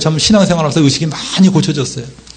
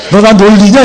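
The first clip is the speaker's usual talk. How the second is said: loud, high comic protest; mock outrage; 4 semitones higher, 6 dB louder than usual. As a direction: burst out as the exasperated friend. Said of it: "그러면 때려 죽이고 싶지?".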